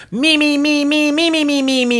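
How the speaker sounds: high mocking self-centred chant; whiny me me me. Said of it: "it's like".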